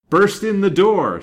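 high, loud ringing command; detective's commanding shout. A narrator's reading. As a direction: call out the order to your men. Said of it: "said the detective in a ringing voice".

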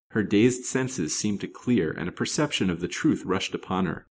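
ordinary delivery, nothing marked

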